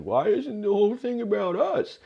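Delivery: high mock whine; whiny audience voice. Comic mocks needy crowd, high whiny voice. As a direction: whine like an audience that wants all the attention. Mocking self-centered audience.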